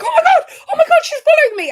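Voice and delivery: very high, loud fan shriek with laughter in it; mock ecstatic squeal. Athlete finding her idol follows her, imitated ecstatic squeal.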